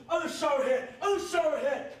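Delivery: ordinary delivery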